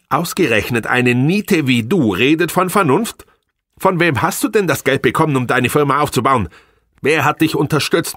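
high, contemptuous scolding woman; scornful wife. Acted. Angry wife berating her failing husband, high and scornful. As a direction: lash him with every reproach.

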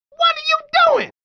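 high, startled challenge; alarmed protest. Acted.

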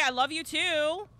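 normal talking voice

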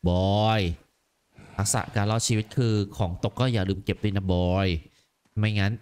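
low, grave father voice; dubbing the father, low and heavy; stern warrior father. Acted. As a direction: speak as a hardened warrior father. Father lecturing son.

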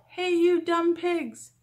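high, loud, drawn-out shout; full storybook yell. A narrator's reading. Megan hollering at the pigs at school, high drawn-out yell. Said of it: "Megan yelled".

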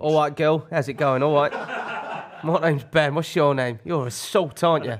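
put-on laddish chat-up voice; Producer Ben on Talk Sport. Producer chats up a girl on sports radio, laddish impersonation. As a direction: greet them like a cheeky radio lad. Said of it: "Producer Ben on Talk Sport".

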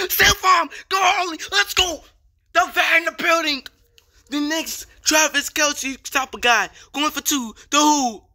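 very high, loud celebration yell; real touchdown excitement at full pitch; hyped shouting. Real, not acted. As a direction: holler in short bursts like a stadium hype man.

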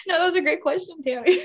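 laughing it off, a bit embarrassed; real laughter after a long rant. Real, not acted. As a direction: giggle through the words. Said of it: "I'm so excited about this".

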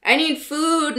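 put-on a hungry teen whining theatrically; mock wail. A narrator's reading. Nick wails to friends, a light mock wail. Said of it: "Nick wailed".

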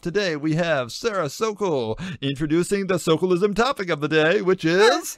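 show host. Acted. Bringing listeners back and cueing the sister, announcer voice. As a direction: announce it like a host, rising to a big cue.

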